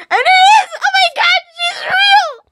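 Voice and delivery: very high, loud laughing scream; laughing shriek. Real, not acted. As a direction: squeal with disbelieving joy. Fan freaking out.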